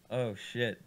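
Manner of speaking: ordinary delivery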